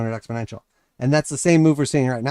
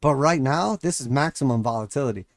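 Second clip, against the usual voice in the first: ordinary talk, nothing marked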